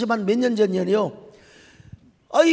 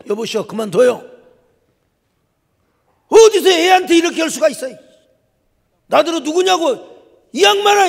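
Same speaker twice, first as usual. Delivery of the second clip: much louder and higher, outraged yelling; furious confronting; 10 semitones higher, 10 dB louder than usual. Retold or imitated. Father yells at the technician hurting his son, furious shout. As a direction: grab him and shout him down.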